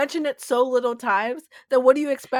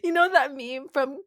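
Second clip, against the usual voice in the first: ordinary delivery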